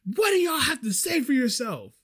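high-pitched, accusing demand; mock indignant customer. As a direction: imitate an irate customer demanding answers. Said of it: "puts it back in my face".

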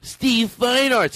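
high, slow, showy pronunciation; fancy name-saying. Shown as a demonstration. Host flattering a director with his name, fancy. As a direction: savor the name as fancily as you can. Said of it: "said his name as fancy as we could".

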